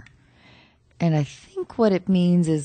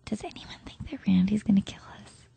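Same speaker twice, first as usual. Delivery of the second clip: hushed conspiratorial whisper; 4 semitones higher, 5 dB quieter than usual. Acted. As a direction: whisper it to the group. Group meeting whisper.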